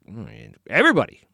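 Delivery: deadpan disciples stating the obvious; mock-obvious everybody, jumping up in pitch; dry sarcastic reply. Disciples voiced answering Jesus.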